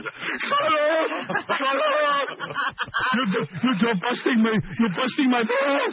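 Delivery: high, laughing mock yell; hammed-up angry father; retold dad yelling. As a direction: scream it like an irate dad, half laughing. Dad snapping at curious son.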